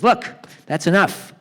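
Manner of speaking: loud, exasperated telling-off; getting madder. Retold or imitated. Doctor finally losing patience with Harry, mad. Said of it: "the doctor's getting madder and madder".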